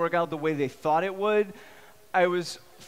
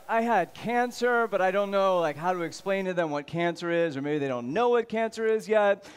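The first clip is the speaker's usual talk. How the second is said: ordinary talk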